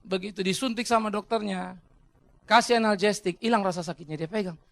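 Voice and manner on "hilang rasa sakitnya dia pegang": ordinary talk, nothing marked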